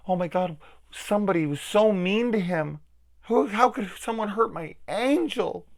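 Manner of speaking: high-pitched, gasping horrified parent; mocking put-on voice of appalled coddling; mock doting parent. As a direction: fret over your precious child, hammed up.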